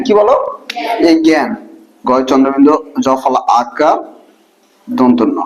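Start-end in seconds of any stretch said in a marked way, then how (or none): none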